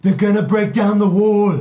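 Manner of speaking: grim warning as Jon Snow; Jon Snow impression. Guest tossing out his Jon Snow to the table, brooding. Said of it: "he does a better Jon Snow than you".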